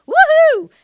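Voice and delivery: very high, loud joyful whoop; celebratory whoop. Retold or imitated. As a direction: celebrate like a kid just won. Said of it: "she'll often go".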